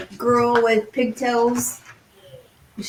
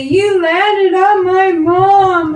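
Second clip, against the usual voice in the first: put-on accusing child; 9 semitones higher, 6 dB louder, much slower than usual. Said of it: "I say".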